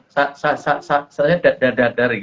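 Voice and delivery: "sa-" and "da-" repeated before the full word comes out; imitated stutter. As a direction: mimic a child stuck on "sa-" and "da-". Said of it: "waktu menjawab mesti gagap gitu". (menirukan gagap, "sa-" dan "da-" diulang-ulang)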